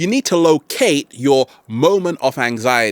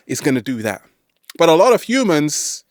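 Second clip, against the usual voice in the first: normal talking voice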